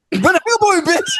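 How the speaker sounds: ordinary talk, nothing marked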